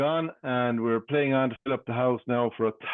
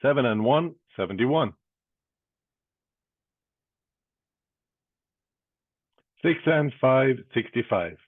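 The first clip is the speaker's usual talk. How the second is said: bingo caller. Acted. Calls bingo numbers.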